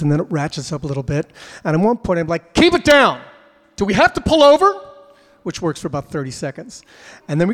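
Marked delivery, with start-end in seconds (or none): 2.5-4.7 loud, high exasperated yell; yelling at the kids. Retold or imitated. Dad yelling at squabbling kids in back seat, loud exasperated shout.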